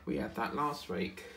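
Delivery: ordinary talk, nothing marked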